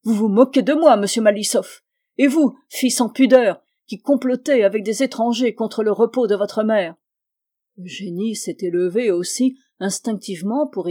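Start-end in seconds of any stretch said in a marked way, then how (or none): 0.0-7.0 apostrophe furieuse, bien plus forte; fureur. Acted. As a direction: accabler sa fille de colère. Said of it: "s'écria madame Berlaguine". (la mère furieuse apostrophe Malisov et sa fille)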